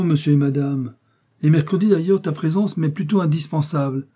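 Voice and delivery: high, loud lecturing rebuke; scolding. A narrator's reading. Pharmacist scolds his fainted apprentice, high and loud. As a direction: tear into the foolish boy.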